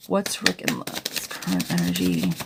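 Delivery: rereading the question in a hammed-up deep voice; mock deep voice. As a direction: drop into a playful deep oracle voice. Rereads client question in put-on voice.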